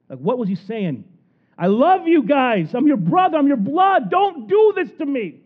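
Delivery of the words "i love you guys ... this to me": desperate plea for mercy. Acted. Joseph begging his brothers for mercy, high and loud. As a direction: beg your brothers not to sell you.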